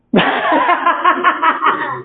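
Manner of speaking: high chuckling laugh; real amused laughing run; burst of laughter. Real, not acted. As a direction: break up laughing. Laughs during her talk.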